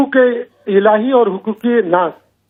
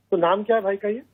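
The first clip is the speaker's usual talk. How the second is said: ordinary talk, nothing marked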